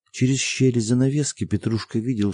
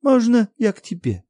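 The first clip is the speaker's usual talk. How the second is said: put-on high, loud child's plea; little girl; 6 dB louder than usual. A narrator's reading. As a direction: ask like a small frightened girl. Child calling to mother.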